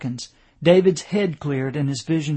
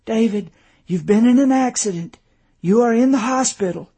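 higher, louder, insistent; anxious wife explaining; 7 semitones higher, 6 dB louder than usual. A narrator's reading. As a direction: make it clear to a dazed husband. Tearful wife explains to husband.

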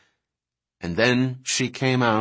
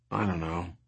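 low, very soft concession; reluctant admission; 5 semitones lower, 10 dB quieter than usual. A narrator's reading. Kelly admits to Sarah that she is unsure about her mom, quietly. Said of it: "Kelly admitted".

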